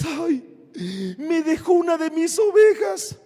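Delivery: mock whining complaint, lightly voiced; whiny pastor. Pastor mocking whiny pastors who lost a sheep, mock whine. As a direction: imitate a sniveling pastor. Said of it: "los pastores chillones".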